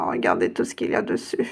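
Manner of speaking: ordinary talk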